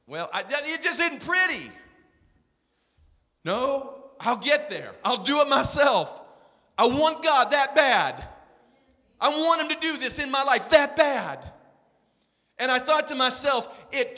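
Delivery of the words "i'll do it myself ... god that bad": normal talking voice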